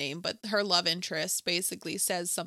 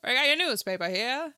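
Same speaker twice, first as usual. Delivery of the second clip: louder mock early-Hollywood accent; old-movie accent; 3 semitones higher, 4 dB louder than usual. Newspaper seller in an early movie, mock Hollywood accent. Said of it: "they talk like that".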